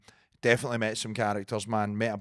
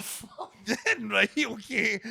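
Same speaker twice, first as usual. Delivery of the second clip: laughing, pumped up; 9 semitones higher, much slower than usual. Retold or imitated. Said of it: "And I was just like".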